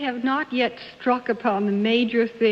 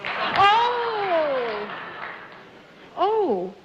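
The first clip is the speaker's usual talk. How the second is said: excited realization; 6 semitones higher than usual. Real, not acted. Panelist hit by an idea.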